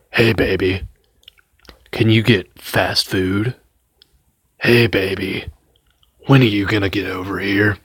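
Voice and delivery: creepy whispered phone-call voice; creepy boyfriend. Retold or imitated. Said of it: "this guy sounds like this".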